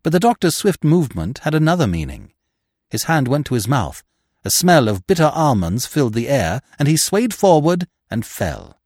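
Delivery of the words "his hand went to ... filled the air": ordinary delivery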